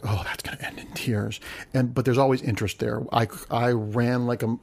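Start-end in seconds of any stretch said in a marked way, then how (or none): 0.0-1.4 hushed, ominous whisper; breathy mock-dread aside; whispered doom. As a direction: whisper it like a bad omen. Mock dread, predicting a flop.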